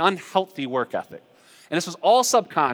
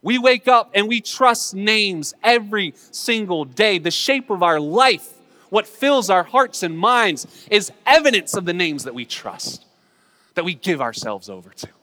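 loud, slow, raised-pitch sermon; emphatic preaching; 5 semitones higher, 6 dB louder than usual. Real, not acted. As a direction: drive each phrase home, slowly and forcefully.

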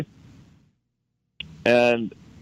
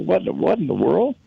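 higher, bewildered exclaiming voice; baffled disbelief; 8 semitones higher than usual. Retold or imitated. Young man reacting to the gold lecture in bewildered disbelief. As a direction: sound thrown by what you just heard. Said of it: "I'm saying".

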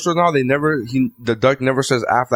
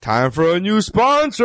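drawn-out, higher sponsor fanfare; mock announcer; 6 semitones higher, slower than usual. Podcast host announcing a sponsor, mock announcer. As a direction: sing out the sponsor intro slowly.

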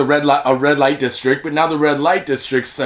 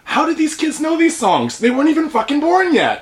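high, disbelieving rant; incredulous; 11 semitones higher than usual. Retold or imitated. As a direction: ask it in total disbelief. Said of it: "I'm like".

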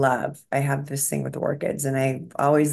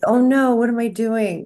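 mock dismay; 6 semitones higher than usual. Guest recalls fretting over trimmed plants, in mock dismay. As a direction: act out a mild little panic. Acts out her past dismay.

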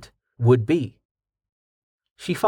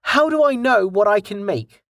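hopeful question; 8 semitones higher than usual. A narrator's reading. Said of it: "Delta asked with hope".

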